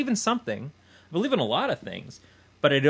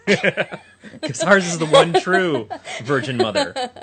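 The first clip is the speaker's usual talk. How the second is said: laughing; 4 semitones higher, 8 dB louder, much slower than usual. Real, not acted. Joking about the one true Virgin.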